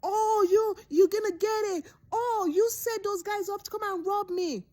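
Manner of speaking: high, mocking accusation; high, hammed-up angry boss blaming her; accusing boss. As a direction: squeal it at her like a petty boss. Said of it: "this silly boss was like".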